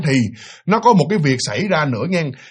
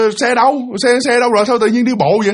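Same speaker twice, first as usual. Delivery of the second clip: higher, puzzled call; puzzled questioning; 6 semitones higher than usual. Acted. Wife asking husband why he is walking, puzzled questioning. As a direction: fire questions at him in surprise.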